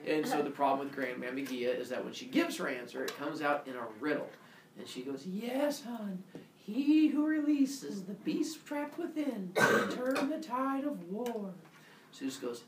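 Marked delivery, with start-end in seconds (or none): 5.3-11.5 high, slow oracle granny; old grandma. Acted. Grandmammy Gaia answers in a riddle, high slow granny voice. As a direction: draw the riddle out in an elderly voice. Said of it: "Grandmas get a little old".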